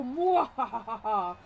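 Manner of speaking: slow, loud villain mwahaha; maniacal laugh. Retold or imitated. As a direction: cackle it like a gloating skull. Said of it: "I just did the laugh".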